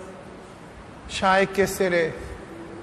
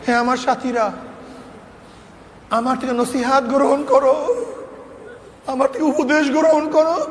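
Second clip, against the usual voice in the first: higher-pitched plea, rising on each last word; urgent exhortation; 4 semitones higher than usual. Retold or imitated. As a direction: implore your disciples to take heed. Sheikh pleads with his disciples.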